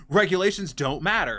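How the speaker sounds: mock a pushy, dismissive customer. Customer to clerks checking regulations, dismissive.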